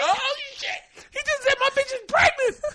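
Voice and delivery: very high squeal in put-on accent; hammed-up schoolkid thrilled by a fight; wildly excited. Imitating husband announcing the insult to his pregnant partner, wildly excited. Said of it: "I don't know what accent I'm doing".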